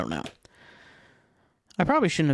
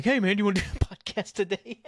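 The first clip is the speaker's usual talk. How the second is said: higher, breaking into a laugh mid-question; casual imagined invite, laughed through; laughing mock invite; 5 semitones higher than usual. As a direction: crack up while acting out a casual ask.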